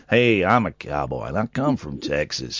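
hammed-up Texas cowboy drawl; Texan cowboy. Host pretends to be a Texan to his co-host, low slow Texan drawl. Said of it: "Texas, they talk more like that".

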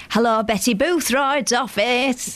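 slow, hammed-up posh secretary voice; lightly hammy fake secretary answering the phone. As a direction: put on a mock-refined receptionist. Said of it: "It was proper hammy like that".

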